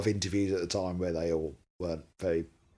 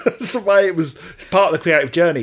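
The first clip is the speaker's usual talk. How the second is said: laughing; 7 semitones higher, 8 dB louder than usual. Real, not acted. Still in jokey voice.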